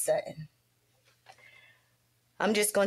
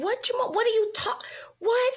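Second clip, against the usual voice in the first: higher, rising panic; alarmed disbelief; 13 semitones higher than usual. Retold or imitated. Mother hearing her son has tumors, higher alarmed disbelief. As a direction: ask over and over, not believing it. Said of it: "I'm like".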